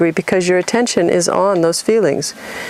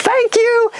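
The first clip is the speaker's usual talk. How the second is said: excited thanks. Real, not acted. Delighted agreement with the listener.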